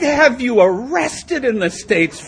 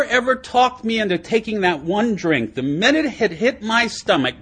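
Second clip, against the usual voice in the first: ordinary delivery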